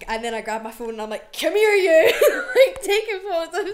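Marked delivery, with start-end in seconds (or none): 1.4-2.1 loud laughing yell, much higher; laughing mock shout at the biter. 2.2-3.7 laughing. Real, not acted. Laughing while retelling.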